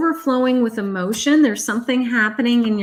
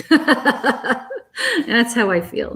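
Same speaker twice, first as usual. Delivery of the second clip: slow, laughing through the words; real chuckle through "That's how I feel"; 4 semitones higher, much slower than usual. Real, not acted. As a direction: laugh it out, amused at herself. Laughs while stating her opinion.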